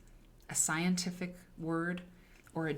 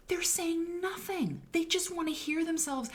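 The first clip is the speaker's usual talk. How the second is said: exasperated; 11 semitones higher than usual. Real, not acted. Mocking academics to students, high scornful. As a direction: scoff at them in a high voice.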